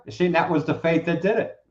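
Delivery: ordinary talk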